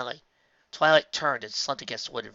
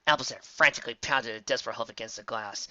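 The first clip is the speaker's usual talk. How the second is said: ordinary delivery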